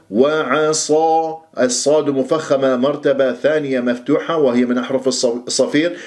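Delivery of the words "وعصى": وعصى very slow, thin ayn then heavy sad; light ayn. Shown as a demonstration. Teacher repeats the correct model, light ayn in وعصى. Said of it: "لا تفخم العين".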